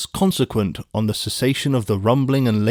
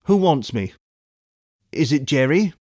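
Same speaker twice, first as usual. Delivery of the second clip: higher, slightly uneasy questions; mildly quavering; 4 semitones higher than usual. A narrator's reading. Passenger answering the call from the mist, mildly quavering. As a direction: ask with a mild quaver. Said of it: "with mildly quavering speech".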